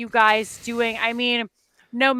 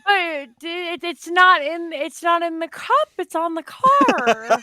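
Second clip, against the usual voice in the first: high, stammering confusion; baffled, stretching out the last words; bewildered; 7 semitones higher than usual. Real, not acted. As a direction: puzzle it out aloud in a raised pitch. Confused about ice on car.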